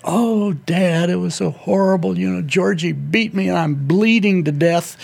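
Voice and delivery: high, whiny kid wailing to his dad, hammed up; hammed-up whimpering boy crying to Dad; mock whiny sob story. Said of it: "he was like".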